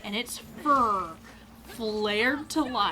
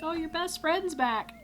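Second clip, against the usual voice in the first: high, mock-sweet lilt; teasing; 8 semitones higher than usual. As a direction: imitate a friend needling you. Imitates friend teasing about monster.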